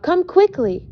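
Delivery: high urgent call. A narrator's reading. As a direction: call him over, high and pressing. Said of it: "I call out".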